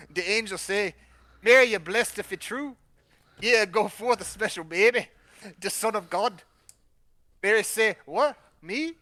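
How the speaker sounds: hammed-up Jamaican accent, livelier and lilting. Guest voices the angel speaking to Mary, Jamaican accent. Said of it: "but in a Jamaican accent".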